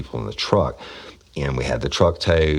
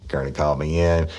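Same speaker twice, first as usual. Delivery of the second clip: usual speaking voice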